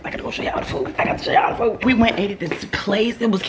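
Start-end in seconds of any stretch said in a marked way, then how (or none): 0.1-1.5 hushed whisper to the viewers; voiceless, confiding whisper. Real, not acted. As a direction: whisper it like a secret aside. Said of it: "why am I whispering?".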